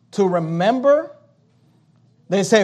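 ordinary talk

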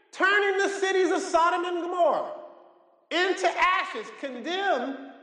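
very high, drawn-out declamation; preached scripture at full force. Acted. Preacher reading of judgment to the congregation, high-pitched proclamation. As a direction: proclaim it high and slow, phrase by phrase.

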